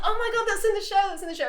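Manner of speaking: high-pitched retold delight; excited fan. Fan recognises something from the show, high excitement. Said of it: "And I was like".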